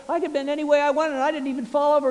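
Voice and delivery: high, loud, quick retold boyish glee; carried-away excitement, acted out; gleeful boasting. Boy revelling in his mud game.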